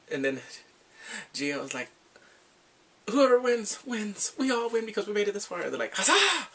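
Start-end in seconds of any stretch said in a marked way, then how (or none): 3.1-5.6 rally the contestants, pitched high; rallying cry. Retold or imitated. Viewer voices Gio cheering on contestants, rallying. Voicing Gio's rallying speech. 5.9-6.5 high, loud mock cheer. Viewer imitates the contestants cheering, high and loud.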